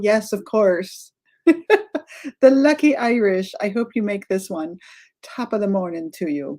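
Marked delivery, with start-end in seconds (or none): 1.5-2.0 a quick, bright burst of laughter; high laugh. Real, not acted. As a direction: laugh out loud at the joke. Laughs at the Irish comment.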